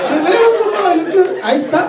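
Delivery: high-pitched, lightly acted little-kid thrill; excited child. Boy to his mom over a lunchbox, acting excited. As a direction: pipe up like an eager kid.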